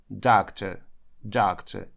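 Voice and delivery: ordinary delivery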